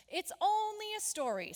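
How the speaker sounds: lightly mocking schoolchild; dismissive classmates. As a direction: brush it off in a sing-song. Scoffing classmates in story.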